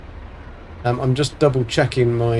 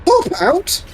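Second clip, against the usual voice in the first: incredulous echo. Real, not acted. Echoing a viewer's excuse, disbelieving and high. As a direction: pitch shoots way up in mock disbelief.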